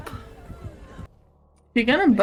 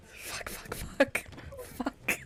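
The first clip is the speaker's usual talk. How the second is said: breathless whispered swearing; whispered cursing; 11 semitones higher, 14 dB quieter, much slower than usual. Real, not acted. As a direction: hiss curses under your breath. Caught in horror game.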